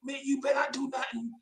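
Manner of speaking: high, quiet nervous warning; a high, hushed mock of a nervous brother; brother's warning. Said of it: "My brother was like".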